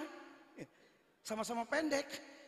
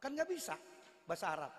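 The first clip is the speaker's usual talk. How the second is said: ordinary talk, nothing marked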